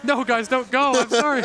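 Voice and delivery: high, loud mock plea; mock pleading. Runner begging the audience not to leave, mock-desperate shout. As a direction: call them back in playful panic. Jokingly calling back departing audience.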